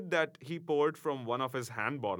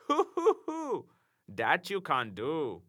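high hooting then slow taunt; mocking hoot-laugh; 4 semitones higher, slower than usual. A narrator's reading. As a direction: hoot at him, then sneer. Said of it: "said the fellow".

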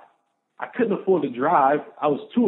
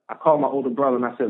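ordinary talk

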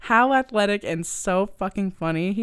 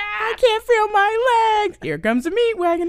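hammy victim; 6 dB louder than usual. Acted. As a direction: ham up the injured driver. Reenacting car crash scene.

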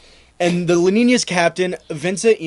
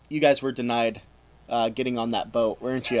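low, soft accusation; mock suspicion; 6 semitones lower, 7 dB quieter than usual. Columbus to his returning crew, quiet mock suspicion. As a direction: ask it low and quiet, knowingly.

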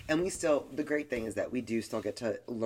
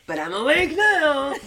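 laughing protest; 14 dB louder than usual. Retold or imitated. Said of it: "I'll be like".

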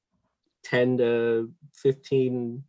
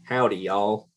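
lightly drawled Southern howdy, a hint of Texas twang; Southern accent. Shown as a demonstration. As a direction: greet them like a Southern cowboy, Southern accent only lightly put on. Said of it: "Can you do a Southern accent?".

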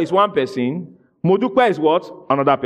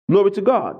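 ordinary delivery

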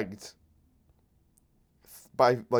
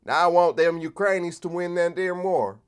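mock Southern accent; 7 semitones higher, 4 dB louder than usual. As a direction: play a dumb liberal with a put-on Southern accent. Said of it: "give liberals the Southern accent".

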